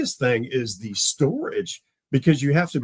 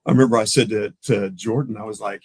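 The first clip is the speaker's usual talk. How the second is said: normal talking voice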